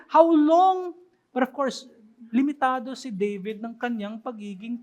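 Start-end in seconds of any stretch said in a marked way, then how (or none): 0.1-0.9 louder, higher cry of despair. Retold or imitated. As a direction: raise the voice, pleading hard. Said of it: "Sabi niya". (malakas at mataas na pagsusumamo)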